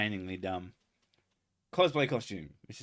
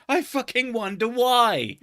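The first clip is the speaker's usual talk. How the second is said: high, loud and slow sarcasm; sarcastic; 12 semitones higher, 8 dB louder than usual. Retold or imitated. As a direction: drag out the scorn, pitched way up. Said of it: "And I'm like".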